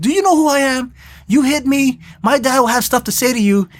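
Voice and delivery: put-on indignant young thug. Thug confronting the chop shop guy who slapped him, mock indignant. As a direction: lightly act out an entitled punk's threat.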